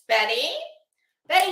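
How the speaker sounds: ordinary delivery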